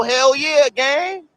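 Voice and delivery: ordinary delivery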